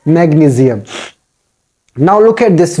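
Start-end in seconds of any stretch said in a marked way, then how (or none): 0.1-0.8 "magnesium" with a z for the s; corrected magnesium. Shown as a demonstration. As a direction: say "magnesium" as mag-nee-zee-um. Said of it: "Change the sound of S with Z".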